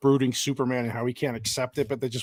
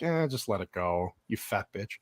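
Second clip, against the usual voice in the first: lower, softer and slow, fading to a grumble; gruff Gordon brush-off; 5 semitones lower, 9 dB quieter than usual. Retold or imitated. Friend mimicking Gordon dismissing Selina, low, slow grumble. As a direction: drawl it out like a tired old cop. Gordon quoted in movie recap.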